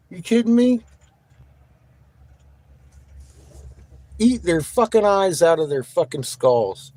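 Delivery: high, incredulous outburst; angry rant. Real, not acted. Streamer goading moderators against spammers, angry. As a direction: rant at them, punching each curse.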